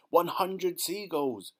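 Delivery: high, loud and slow count called out; triumphant shout. Acted. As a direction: announce the count proudly. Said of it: "he shouted".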